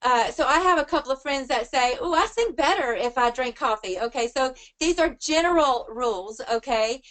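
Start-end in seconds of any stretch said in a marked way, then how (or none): none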